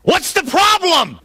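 high, drawn-out shout over the wind; yelling back. Acted. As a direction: holler the question so it carries. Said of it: "he has to yell back".

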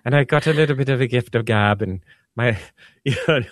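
a light, playful Irish lilt; Irish accent. Pretend. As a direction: slip into a hint of Irish brogue. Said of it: "I shouldn't be doing an Irish accent".

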